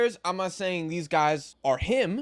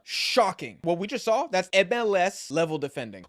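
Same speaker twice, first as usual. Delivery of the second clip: ordinary talk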